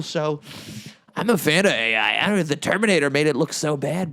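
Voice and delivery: normal talking voice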